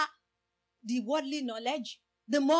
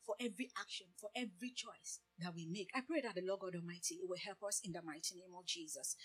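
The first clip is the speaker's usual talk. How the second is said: much softer, lower closing prayer; hushed prayer; 7 semitones lower, 16 dB quieter than usual. Real, not acted. Preacher closing the point, soft low prayer.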